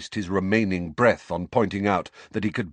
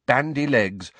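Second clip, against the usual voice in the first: ordinary delivery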